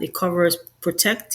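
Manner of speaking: normal talking voice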